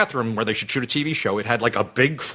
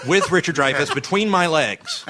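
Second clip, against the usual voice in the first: normal talking voice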